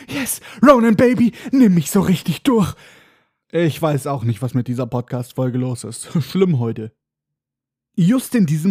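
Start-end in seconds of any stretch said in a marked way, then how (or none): 0.1-2.7 high, loud mock-ecstatic cry; Hermine roaring. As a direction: yell it out in hammed-up passion. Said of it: "und gebrüllt hat".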